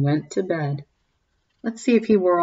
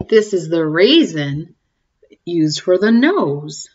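story reading; 3 semitones lower, 3 dB louder than usual. A narrator's reading. Reading a cumulative rhyme to the kids, sing-song. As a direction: read it like a bedtime picture book. Reads picture book aloud.

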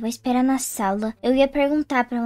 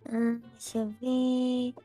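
slow musing; 3 semitones lower, 9 dB quieter, slower than usual. Acted. Doctor checking paper slowly.

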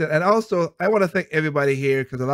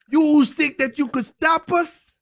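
mock tough taunt; 13 semitones higher, 4 dB louder, slower than usual. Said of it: "You'd be like".

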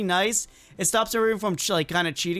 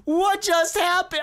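laughing disbelief; 7 semitones higher, slower than usual. Real, not acted. Laughing at sudden event.

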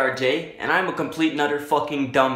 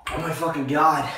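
normal talking voice

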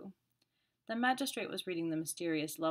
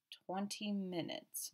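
very slow, softer musing; 3 semitones higher, 4 dB quieter, much slower than usual. Acted. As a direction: turn the number over slowly. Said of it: "mused the commissary".

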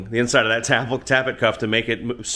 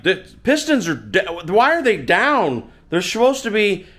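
flustered; 9 semitones higher than usual. Retold or imitated.

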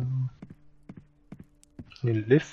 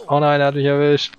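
loud mock dismay; 3 semitones higher, 8 dB louder than usual. Caught by enemy in game.

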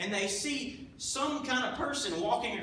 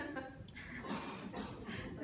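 quiet breathy chuckle; real soft laugh; 4 semitones higher, 12 dB quieter than usual. Real, not acted. Preacher chuckles to the congregation at his own joke.

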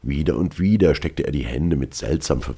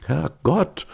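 high-pitched, nervy exclamation; nervous exclamation; 12 semitones higher than usual. A narrator's reading. Marvel hears the sailor's account and glances round, nervous. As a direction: pitch jumps up uneasily. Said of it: "nervös nach allen Seiten blickend".

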